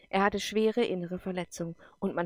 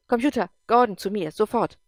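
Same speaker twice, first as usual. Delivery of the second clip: urgent command; 3 semitones higher, 8 dB louder than usual. Acted. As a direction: summon him at once, loudly.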